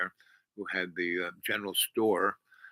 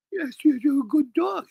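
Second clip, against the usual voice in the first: much higher, sing-song praise for a dog; dog baby talk; 5 dB louder than usual. Retold or imitated. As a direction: coo at the dog like it's a baby.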